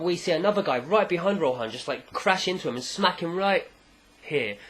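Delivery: ordinary delivery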